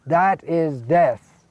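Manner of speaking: normal talking voice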